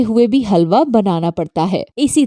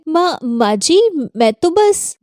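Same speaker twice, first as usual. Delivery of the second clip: flustered; 6 semitones higher than usual. Acted. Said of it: "Meena घबरा जाती है".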